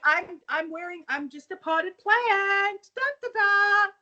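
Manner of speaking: high, drawn-out mock sneaking; sing-song pretence of being a potted plant; hammed-up sneaky innocence, pitched high; stage cat burglar. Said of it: "like, a cat burglar".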